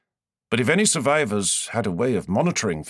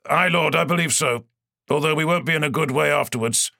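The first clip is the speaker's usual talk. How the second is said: raised, urgent report from a captain under fire; tense ship captain; 6 semitones higher than usual. A narrator's reading. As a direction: report the damage to your lord. Captain answers.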